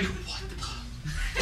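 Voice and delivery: voiceless baffled whisper; whispered bafflement. Retold or imitated. Teen after the girl's scream, baffled whisper. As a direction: trail off in confusion.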